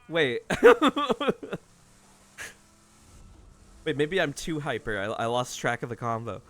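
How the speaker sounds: wired, laughing, bouncing energy; over-caffeinated giggly rush; hyper and giddy. Real, not acted. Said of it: "I'm fucking wired".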